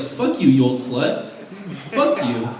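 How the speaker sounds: ordinary talk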